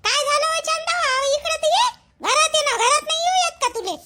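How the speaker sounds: a neighbour woman, pitched high and curious; high neighbour voice asking what's going on; high-voiced neighbour woman. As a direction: play the nosy neighbour, voice lifted high. Second woman voiced in skit.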